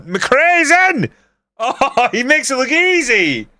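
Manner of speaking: very high, ecstatic yell; excited goal call. Real, not acted. Gamer watching McRae get through on goal, excited high cry. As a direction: cheer the player on at full pitch.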